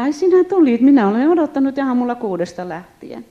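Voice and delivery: put-on high, loud, delighted greeting of an old woman; excited old woman. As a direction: gush like a grandma who has waited since dawn.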